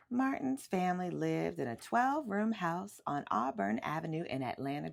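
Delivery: ordinary delivery, nothing marked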